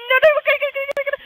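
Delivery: high-pitched rapid chatter; tense high babble mid-play; frantic. Real, not acted. Frantic during live play.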